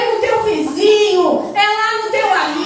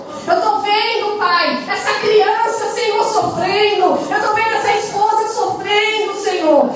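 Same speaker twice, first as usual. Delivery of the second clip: usual speaking voice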